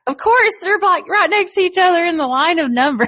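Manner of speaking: exasperated. Retold or imitated. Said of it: "I'm like".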